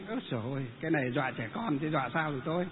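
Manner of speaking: low, quiet thinking to oneself; inner thought. Retold or imitated. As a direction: thought voiced softly, low. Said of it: "Mình nghĩ trong bụng".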